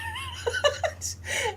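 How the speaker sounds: high-pitched giggle fit; high squealing laugh. Real, not acted. Laughing at the clerk getting told off, squealing giggle.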